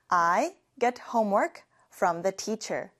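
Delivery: I get homework from the teacher, said slowly and evenly; slow repeat-after-me. Shown as a demonstration. Teacher leads class repetition, very slow and clear. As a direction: model it slowly for the class to copy. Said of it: "같이 해볼게요".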